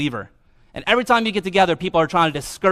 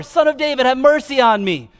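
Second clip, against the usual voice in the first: shouted plea; 8 semitones higher, 5 dB louder than usual. Retold or imitated. Blind man shouting to Jesus over the crowd, loud pleading. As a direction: call out over a noisy crowd. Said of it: "he shouted even louder".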